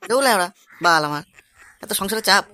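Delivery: low-pitched cursing grumble; put-on low, fed-up voice; grumbling family elder. Elder grumbles about family burdens.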